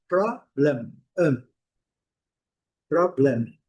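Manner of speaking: problem with a weak schwa. Shown as a demonstration. Teacher modeling problem for the class. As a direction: say problem ending in a schwa and closed lips on m. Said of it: "A letra E tem o som do schwa".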